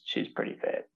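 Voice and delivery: very soft, nearly whispered private thought; under the breath, admiring; confiding aside. Retold or imitated. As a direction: drop to a hushed aside. Private thought on seeing future wife.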